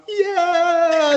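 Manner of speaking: long, high mock squeal; retold inner cheer. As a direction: stretch it high like a secret celebration. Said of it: "inside, I'm trying to be cool".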